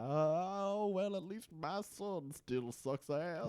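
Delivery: Southern con man sneering at his son; lightly hammed Southern accent; mock Southern drawl. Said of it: "That's how he sounded throughout the episode".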